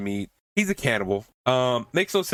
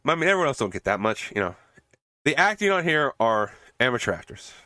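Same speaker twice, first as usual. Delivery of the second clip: usual speaking voice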